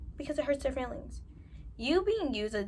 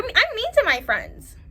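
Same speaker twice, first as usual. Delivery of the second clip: mock mean friend; 11 semitones higher, 7 dB louder, slower than usual.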